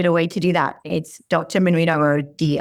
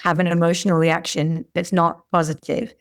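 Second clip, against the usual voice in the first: ordinary talk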